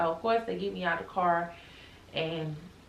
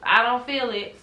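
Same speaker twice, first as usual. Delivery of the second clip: louder, higher complaint; frustrated protest; 7 semitones higher, 4 dB louder than usual. Retold or imitated. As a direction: insist it isn't working. Morphine not working, arguing.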